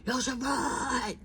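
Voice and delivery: high, slow, drawn-out holler; stretched Bronx street holler; yelling out the window. Retold or imitated. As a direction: call her name down from the window. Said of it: "he yells out".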